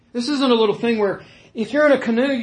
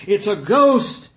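drawn-out, louder cry of alarm; acted frightened cry; 2 semitones higher, 6 dB louder, slower than usual. Preacher voices the terrified disciples, acted frightened cry. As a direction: cry out slowly in mock fright. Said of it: "they're crying out like little children".

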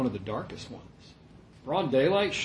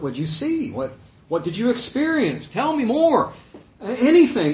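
higher-pitched, eager plea; eager questioning; 7 semitones higher than usual. Acted. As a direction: beg excitedly to hear more. Imagines eagerly questioning raised Lazarus.

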